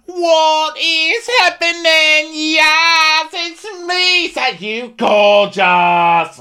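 very high, loud, drawn-out shriek; hammed-up campy squeal, almost sung; over-the-top party guy. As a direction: screech it slowly as a flamboyant imitation. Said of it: "he's definitely going there".

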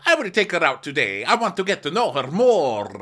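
high, loud put-on declaration; hammed-up imitation, swelling on the last word; mock eager suitor. Imitating him vowing to take her out, loud high voice.

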